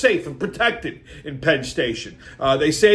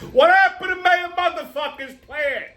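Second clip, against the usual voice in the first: indignant rant; 10 semitones higher than usual. Real, not acted. Ranting at the mayor.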